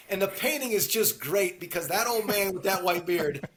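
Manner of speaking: ordinary delivery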